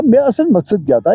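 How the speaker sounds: ordinary talk, nothing marked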